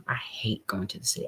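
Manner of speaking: breathy whisper, confiding; hushed private complaint; whispered aside. Real, not acted. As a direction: drop to a whisper as if letting them in on a secret. Aside about hating the city.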